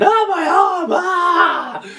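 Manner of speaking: very high, hammed-up shriek; mock screaming. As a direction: scream in exaggerated agony. Acting out people being eaten.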